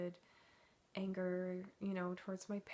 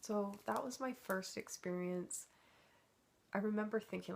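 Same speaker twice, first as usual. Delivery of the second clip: usual speaking voice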